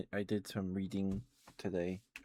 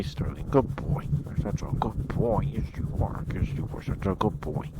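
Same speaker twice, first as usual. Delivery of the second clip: praising the dog; 8 dB louder than usual. Real, not acted.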